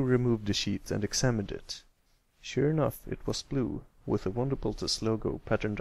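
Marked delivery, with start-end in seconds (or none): none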